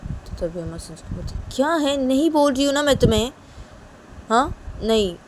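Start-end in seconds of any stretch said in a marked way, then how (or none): 1.5-5.2 high, loud, exasperated protest; exasperated scolding. Acted. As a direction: scold an insistent lover, voice pitched up. Uma scolds Sanju, urging him down. (झुंझलाकर ऊँची आवाज़ में डाँटना)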